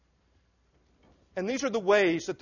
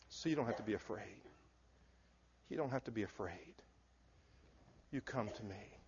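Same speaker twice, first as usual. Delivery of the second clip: much softer and lower, hushed and tender; gentle near-whisper; 7 semitones lower, 12 dB quieter than usual. Acted. Preacher voicing Jesus inviting the weary, hushed and tender.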